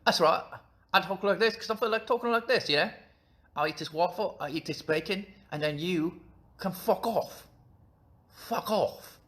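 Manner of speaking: high, exaggerated Cockney; hammed-up mock Cockney squawk; Cockney accent. Shown as a demonstration. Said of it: "the Cockney accents I do is like".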